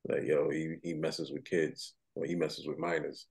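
low, quiet, slowed confidential voice; hushed warning. Acted. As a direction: pass on a secret warning under your breath. Acted confiding of a secret warning.

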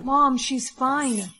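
slow, loud, drawn-out command. Acted. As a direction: say it slowly like a hypnotic order. Mind control command to mom.

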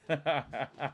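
soft short chuckle under the breath; a small private laugh; quiet laugh. Real, not acted. Streamer laughing quietly at the chat.

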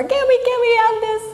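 put-on high, fast whiny child plea; begging kid. Child begging mom in the store, whiny. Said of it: "kids begging their moms".

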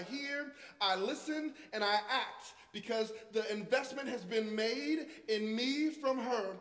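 loud, high, fervent preaching; full-voiced pulpit preaching; preaching at full force. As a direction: preach it out to the congregation.

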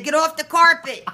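yelling. Retold or imitated. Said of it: "I said".

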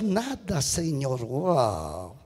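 very low, soft letdown; hushed anticlimax. Acted. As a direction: let it sink to a low hush. Deflated answer to Satan's expectation. (en voz muy baja y grave)

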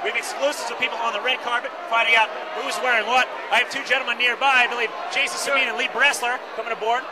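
bright, eager red carpet host; gushing red carpet chatter. Acted. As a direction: gush like an awards-show host. Red carpet host pre-show.

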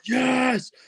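high excited cry, only lightly voiced; retold scream of joy. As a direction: relive the moment you yelled yes in the car. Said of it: "I was literally just screaming".

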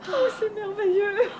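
slow, laughing and teary; joy spilling over into tears; laughing through tears. Acted. Mother-to-be overjoyed the baby is coming, laughing tearfully. About to see her baby.

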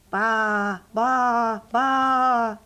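loud, drawn-out baas; sheep bleating. Acted. Striped sheep answer the rabbit, bleating loudly. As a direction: bleat like a little flock.

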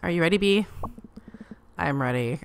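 normal talking voice

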